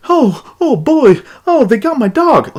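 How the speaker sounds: mock grieving owner. Gamer mocking an NPC who mourns his dog, high hammed-up wail. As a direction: overact a dog owner's grief. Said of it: "or the owner goes".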